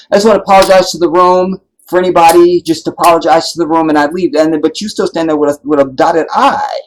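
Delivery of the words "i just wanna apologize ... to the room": a louder, quick, hollow podium apology; mock apology. Speaker mocking an insincere apology to the room, loud and quick. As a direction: rattle off a fake apology.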